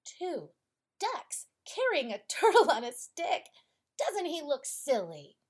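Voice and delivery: loud, high jeering shout; a boy jeering loudly at the flying turtle; mocking shout. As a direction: jeer at the turtle overhead. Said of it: "shouted the boy".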